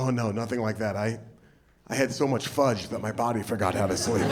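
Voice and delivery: sheepish confession. Pretend. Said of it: "I'm gonna be like".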